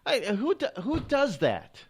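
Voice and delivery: high-pitched incredulous voice; mock disbelief. Reacting to odd local names, mock disbelief. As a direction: ask it in high, baffled disbelief.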